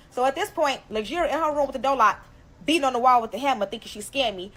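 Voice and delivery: normal talking voice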